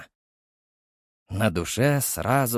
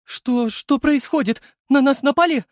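высоко, испуганно, срываясь; в панике спросить, что случилось; испуганный женский голос; 13 semitones higher, 5 dB louder than usual. Acted. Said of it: "испуганная Мариночка". (испуганная девушка спрашивает Черчесова, что происходит)

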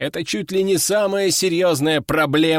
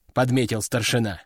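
ordinary delivery, nothing marked

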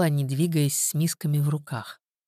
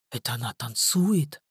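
stunned; 4 semitones lower, 4 dB quieter than usual. A narrator's reading. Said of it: "оторопело произнёс Мишка".

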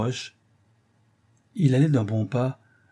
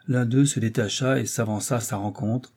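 usual speaking voice

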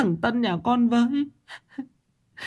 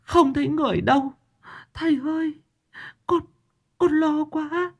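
tearful sobbing plea; 12 semitones higher than usual. A narrator's reading. A weeping mother begs the master to save her son. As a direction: beg through tears, voice pitched way up. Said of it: "bà Tám mếu máo khóc".

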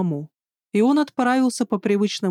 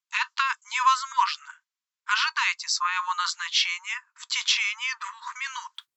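robot voice; 7 dB quieter than usual. Acted. Robot guard refuses.

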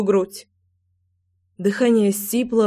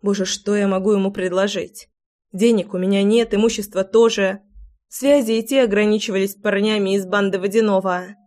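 ordinary delivery

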